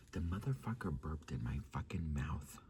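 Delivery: hushed, scandalised whisper. Real, not acted. Telling viewers the burp story, whispered. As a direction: spill the gross secret under your breath. Confiding the gross kiss detail.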